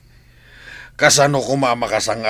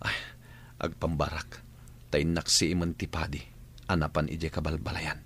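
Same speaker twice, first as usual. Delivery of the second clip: quiet aside; 4 semitones lower, 11 dB quieter than usual. Acted. Boss gives a low aside.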